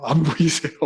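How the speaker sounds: ordinary delivery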